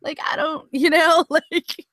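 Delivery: high, laughing, breathless; giggly high. Real, not acted. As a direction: giggle through the words. Laughing nervous rambling.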